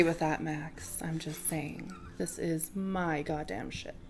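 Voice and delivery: hushed, breathy whisper. Acted. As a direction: say it under your breath. Whispered captain line.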